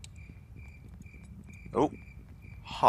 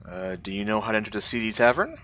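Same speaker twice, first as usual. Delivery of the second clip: ordinary talk, nothing marked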